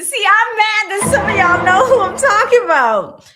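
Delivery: high and loud, half laughing; exasperated. Real, not acted. Streamer scolding chat, laughing and exasperated. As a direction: mad that chat knows him.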